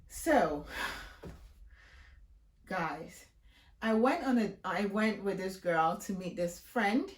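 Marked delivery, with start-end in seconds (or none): none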